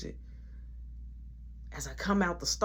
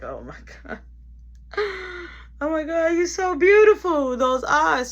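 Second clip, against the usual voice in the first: rising to loud, stretched awe; awestruck inner voice; 10 semitones higher, 6 dB louder than usual. Retold or imitated. Man thinking about Kiki's beauty, awestruck and loud. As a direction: gush over her like you can't believe it. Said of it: "in my mind".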